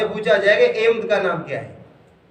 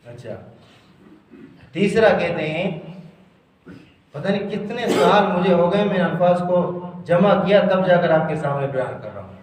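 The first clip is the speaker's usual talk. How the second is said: ordinary talk, nothing marked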